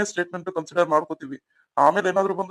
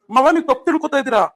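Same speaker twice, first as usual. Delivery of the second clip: raised protest; 6 semitones higher, 5 dB louder than usual. Acted. Husband protests to angry father.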